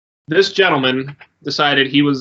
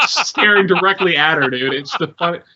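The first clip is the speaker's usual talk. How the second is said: ordinary talk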